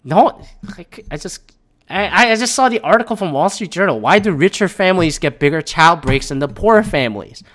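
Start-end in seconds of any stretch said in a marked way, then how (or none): none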